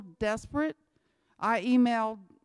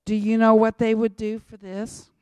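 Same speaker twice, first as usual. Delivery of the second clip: loud, hammering question at the crowd; fiery preaching; 9 dB louder than usual. Real, not acted. Preacher challenging the church, loud and fiery. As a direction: drive the question home loudly.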